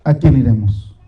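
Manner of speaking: low, hushed, unhurried prayer; oración íntima. Acted. Opening prayer, low and slow. (oración grave, lenta y recogida)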